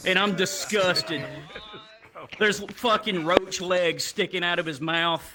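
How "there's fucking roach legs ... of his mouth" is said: ordinary talk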